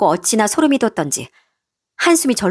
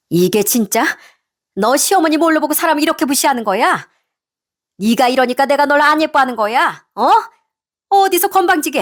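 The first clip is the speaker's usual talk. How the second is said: mother-in-law's acted angry outburst, rising high; angry yelling; 6 semitones higher than usual. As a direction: lash out at an insolent daughter-in-law. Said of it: "제 머리채까지 잡으며 소리를 쳤어요".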